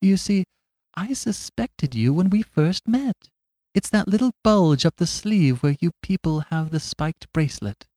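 high, mild and simple; meek little priest. Acted. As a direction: speak like a gentle country priest.

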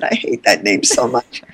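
put-on a touch of a sulky young self; hating the name. Young woman admitting to her boyfriend, sulky.

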